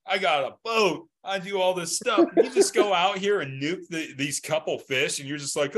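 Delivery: normal talking voice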